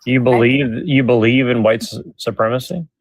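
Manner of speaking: normal talking voice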